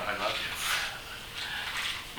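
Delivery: very soft, drawn-out, nearly whispered; hushed retold prayer. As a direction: imitate a believer breathing a slow prayer. Retold believer's tender repeated prayer.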